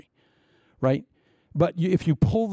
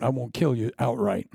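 usual speaking voice

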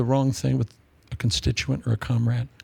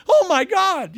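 mock scream; 14 semitones higher, 4 dB louder, slower than usual. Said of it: "We need to scream a little bit more".

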